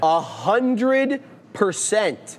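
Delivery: "hundred" stretched long and high; drawn-out emphasis. Real, not acted. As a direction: draw out "A hundred percent" slowly. Repeats emphatic figure.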